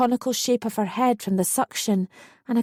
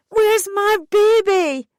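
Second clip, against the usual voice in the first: very high, loud, slow desperate yell; yelled desperately; 11 semitones higher, 6 dB louder, slower than usual. A narrator's reading. Mother facing the doctor's needle, desperate yell. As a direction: yell in despair. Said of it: "I yelled desperately".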